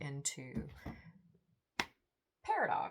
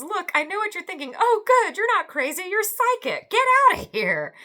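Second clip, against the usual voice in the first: much higher, louder mock guy voice; hammed-up stranger; 18 dB louder than usual. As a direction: play the cocky intruder, loud and high. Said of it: "He goes".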